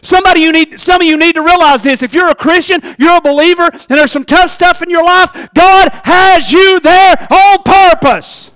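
high, loud preaching, slowing on the last line; full-force preaching. Acted. Preacher telling believers God placed them there, loud. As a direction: drive the point home to the church.

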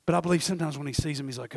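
ordinary delivery

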